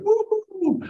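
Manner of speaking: very high, exultant cheer; joyful whoop. Real, not acted. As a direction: whoop it out at the top of the voice. Celebrating the windfall.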